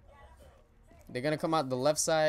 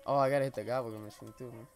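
usual speaking voice

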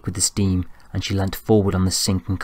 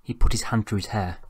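ordinary delivery, nothing marked

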